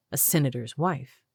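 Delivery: usual speaking voice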